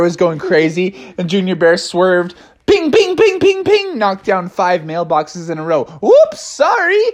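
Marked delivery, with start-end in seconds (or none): 2.7-4.0 high, rapid pings; pinging noises. Retold or imitated. Storyteller imitates mailboxes being knocked down, high pinging. 6.0-7.0 high, drawn-out apology; squeaky cub. Acted. Cub apologises for hitting mailboxes, very high voice.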